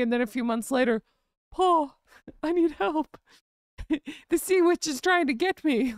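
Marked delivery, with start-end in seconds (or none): 1.5-5.9 mock Harry plea. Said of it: "he would think".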